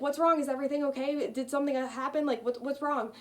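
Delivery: worried guard. Retold or imitated. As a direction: sound worried, checking she's okay. Guard alarmed by sobbing student.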